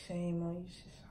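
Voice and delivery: whispered mock scolding. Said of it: "I know you just like".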